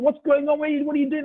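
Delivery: high, loud bewildered questions; a porter baffled by the pre-Passover frenzy, high and loud; bewildered asking. Acted. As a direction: ask the crowd, baffled and urgent. Chaim bewildered by the rush.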